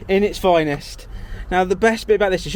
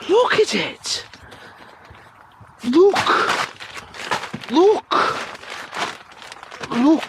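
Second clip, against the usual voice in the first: excited shouting; 10 semitones higher, slower than usual. Real, not acted. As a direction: shout it out, thrilled and amazed. Excited at tiny luxury mattress.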